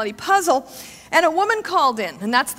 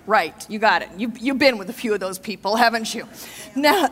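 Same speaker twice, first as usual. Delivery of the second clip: ordinary talk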